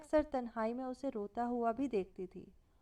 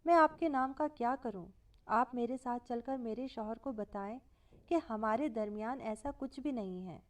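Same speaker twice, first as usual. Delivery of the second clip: usual speaking voice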